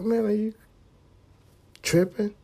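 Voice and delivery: lightly voiced conscience; inner voice. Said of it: "I'm thinking like".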